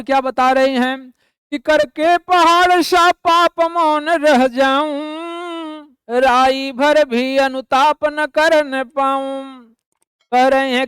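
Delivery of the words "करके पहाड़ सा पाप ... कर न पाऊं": poem recitation. Acted. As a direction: lift the voice and stretch the verse out. Teacher recites the next verse.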